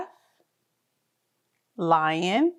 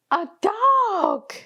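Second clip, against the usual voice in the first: mock surprise; 11 semitones higher than usual. Surprise discovery for kids.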